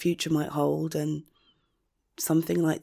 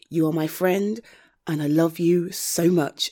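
ordinary talk

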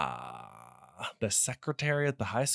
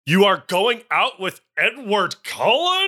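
thundering dad; 7 semitones higher, 6 dB louder than usual. Acted. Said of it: "he thundered".

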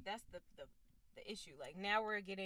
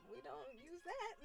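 imitating guest; 7 semitones higher, 6 dB quieter than usual. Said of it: "He's like".

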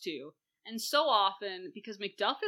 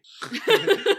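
loud, high burst of laughing; big high laugh that bursts out; laughter; 11 semitones higher, 11 dB louder than usual. Real, not acted. Reacting to how upsetting it is, loud laughter.

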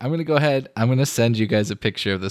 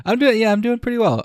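laughing through the words, pitched well up; 11 semitones higher, faster than usual. Real, not acted. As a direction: giggle it out. Laughing while answering.

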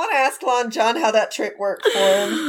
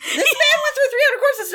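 laughing mock quote; 13 semitones higher than usual. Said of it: "and he says".

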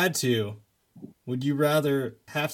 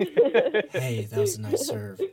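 sweet nothings; much slower than usual. Pretend. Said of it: "whisper sweet nothings in their ear to distract them".

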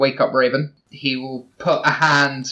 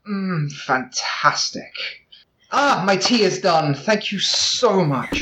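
lingering seductive murmur over the spoon; soft groan; 5 semitones higher, slower than usual. Acted. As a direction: draw it out slowly, savoring the taste. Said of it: "soft groan and just goes".

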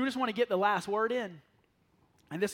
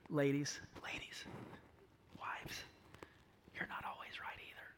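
unvoiced stage whisper to the wives; 7 semitones lower, 14 dB quieter than usual. Shown as a demonstration. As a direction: whisper it so only the ladies hear. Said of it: "I'll say this one quieter".

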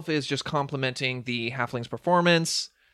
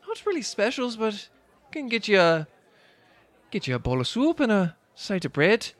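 higher-pitched put-on server voice; tavern server; 10 semitones higher, 3 dB louder than usual. Server offers soup to a customer, higher character voice. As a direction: take the order as a high-voiced server.

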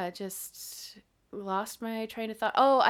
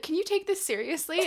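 mock exasperation; 8 semitones higher than usual. Voicing exasperated people in her past.

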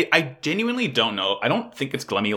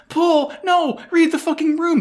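much higher, louder exasperated cry; exasperated plea; 4 dB louder than usual. Real, not acted. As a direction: beg the character to stop. Pleading with a character on screen.

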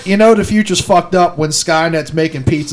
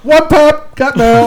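higher, louder chirpy call; mock chirpy shout; 6 semitones higher, 3 dB louder, much slower than usual. Joking with friends in a chirpy robot voice. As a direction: repeat the bit like a cheery robot. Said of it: "continuing the mock voice".

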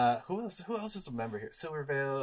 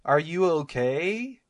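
slow, drawn-out incredulous rise; exaggerated disbelief; 6 semitones higher, 6 dB louder, much slower than usual. Real, not acted. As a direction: stretch it out like he's lost his mind. Friend calls the accent normal.

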